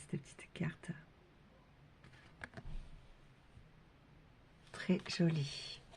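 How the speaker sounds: hushed whisper to herself. Real, not acted. Card reader whispering while laying the card. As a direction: whisper as if not to disturb.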